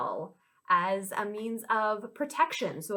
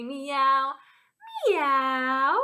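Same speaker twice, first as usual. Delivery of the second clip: playful cat meow; 2 semitones higher, 3 dB louder than usual. Pretend. Teacher leading children in animal sounds, playful meow.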